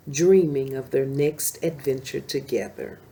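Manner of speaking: ordinary delivery, nothing marked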